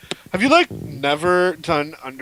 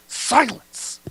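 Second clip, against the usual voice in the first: milder, higher stern command; softer command; 6 semitones higher than usual. Acted. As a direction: order silence with less force. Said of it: "that's terrible".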